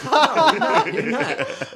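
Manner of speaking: high, loud laughing protest. Real, not acted. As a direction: laugh through the denial.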